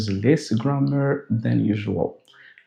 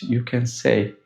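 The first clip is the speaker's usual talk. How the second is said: ordinary talk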